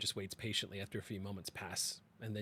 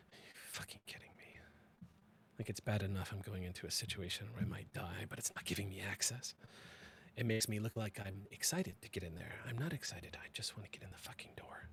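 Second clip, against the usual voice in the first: frustrated whisper; 7 dB quieter than usual. Acted. Frustrated at locked door.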